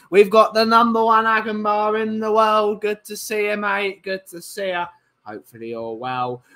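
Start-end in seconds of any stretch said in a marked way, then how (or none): none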